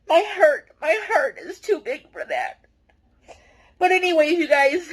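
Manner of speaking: real tears held back as the words come out; choked-up pleading with viewers, fighting real tears. Real, not acted. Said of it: "I'm trying not to cry".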